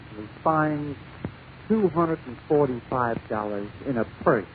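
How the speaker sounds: ordinary delivery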